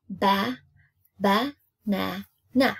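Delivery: BA, NA, NA said as separate beats; syllable by syllable. Shown as a demonstration. Teacher showing pupils how to chop a word, syllable by syllable. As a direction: chop "Ba, ba, na, na" into single syllables with gaps. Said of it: "you're gonna chop it".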